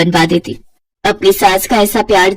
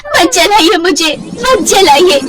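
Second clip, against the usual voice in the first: mild mock scream; 8 semitones higher, slower than usual. Simran cries out as the hot wood touches her, high slow plea. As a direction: cry out in remembered terror. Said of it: "जोर जोर से चिल्लाती है".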